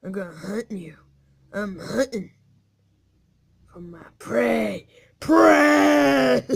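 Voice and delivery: drawn-out triumphant shout of prey; gloating hunter shout. Acted. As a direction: roar it like a hungry wolf, then laugh. Wolf hunting, gloating.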